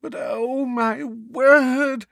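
high, dramatic lament; tragic. A narrator's reading. Neighbour wringing her hands over the disaster, tragic. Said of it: "tragically wringing her hands once more".

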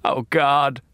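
raspy pained gasp of a word; rasped. Acted. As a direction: rasp it out in pain. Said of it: "he rasped out".

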